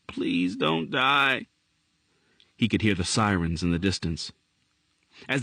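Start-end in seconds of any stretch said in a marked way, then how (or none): 0.1-1.5 moaned plea. A narrator's reading. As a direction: draw out the plea, mildly tearful. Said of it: "he moaned".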